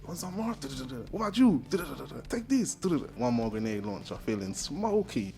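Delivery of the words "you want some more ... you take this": higher, rising taunts; mock Tony taunts. Tony shooting enemies, building high taunts.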